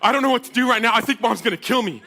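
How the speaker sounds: loud, high, frantic phone call; acting out a scared kid calling for help; panicked plea. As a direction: plead with dad in a rising panic. Scared son phones dad for help.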